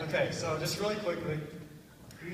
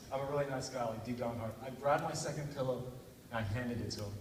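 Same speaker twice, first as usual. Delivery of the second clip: normal talking voice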